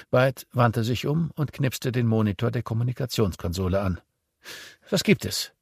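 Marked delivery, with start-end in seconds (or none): 4.9-5.5 impatient. A narrator's reading. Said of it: "fragte Claire ungeduldig".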